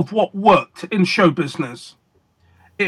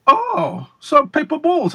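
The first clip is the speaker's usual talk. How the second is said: put-on old master teasing, much higher pitch; Slidini impression; 8 semitones higher, slower than usual. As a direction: lift the voice high and play the amused maestro. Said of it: "I'll give you my Slidini impression".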